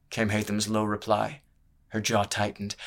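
ordinary delivery

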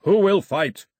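booming shouted demand; yelling. A narrator's reading. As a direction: yell for a challenger. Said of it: "he yelled".

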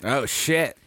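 ordinary delivery

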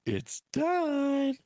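exaggerated high-pitched finish; high mock relief. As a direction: gasp and squeal it out. Acts out getting it over with.

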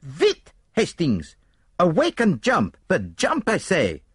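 put-on high, urgent accented cry; piercing squeal. A narrator's reading. Poirot wakes Hastings to jump from train, high urgent squeal. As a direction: squeal the orders in a rush of alarm. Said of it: "Poirot uttered a piercing squeal".

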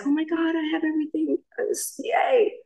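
high, gushing delight; mock giddy gushing; giddy joy. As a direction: squeal it like everything finally worked out. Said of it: "It was just like".

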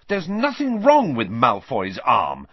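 loud, slow, emphatic fury; furious. A narrator's reading. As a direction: protest angrily, leaning on the words. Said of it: "said Harry furiously".

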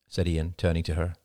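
ordinary delivery, nothing marked

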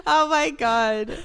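slow, laughing oh my God; cracking up mid-word. Real, not acted. Cracking up at the joke.

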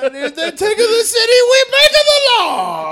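high, loud, sing-song gloating; hammed-up gleeful boast; gleeful Venice. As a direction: crow it like a greedy city-state.

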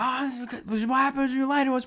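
high, rushed mock rant; mock scolding cop. Cop lecturing the kids, mock scolding. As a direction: rattle off a cop's scolding.

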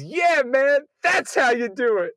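high, loud mock cheer of a rowdy moviegoer. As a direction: bellow it like a guy in the front row. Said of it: "another guy who just goes".

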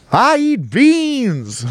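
slow, high southern preacher wail; hammed-up preacher cry, high and stretched. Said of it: "I hit my fucking southern preacher voice there".

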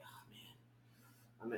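breathy whispered aside. Retold or imitated. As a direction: mutter it under your breath. Unenthusiastic whispered reaction.

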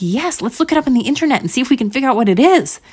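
fast, high, excited urging; eager. Acted. Curious mom coaxing her daughter, quick eager delivery. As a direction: rush it with bright curiosity.

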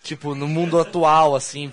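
usual speaking voice

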